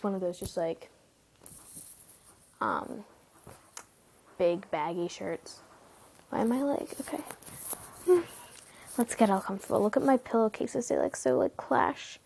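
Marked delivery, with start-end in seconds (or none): none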